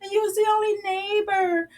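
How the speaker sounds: high, fast, sing-song gushing; mocking the neighbor's over-the-top thanks; gushing mock delight. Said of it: "And so she was like".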